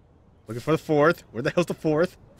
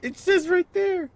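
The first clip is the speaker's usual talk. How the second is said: pitched way up, whining in disbelief; exasperated high whine. Real, not acted. Gamer whining at a puzzle clue, pitched high in exasperation. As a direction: squeal it in exasperation.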